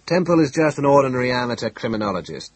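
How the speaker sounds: heavy disdain; scornful. A narrator's reading. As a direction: belittle him with every stressed word. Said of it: "vast amount of scorn in his voice".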